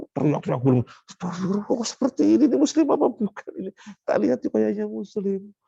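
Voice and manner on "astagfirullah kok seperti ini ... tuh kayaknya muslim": shocked onlooker. Retold or imitated. Onlookers shocked at wailing mourners.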